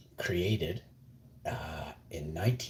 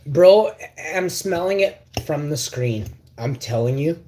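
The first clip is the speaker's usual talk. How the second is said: excited; 8 semitones higher, 11 dB louder than usual. Real, not acted. Excited over the salad.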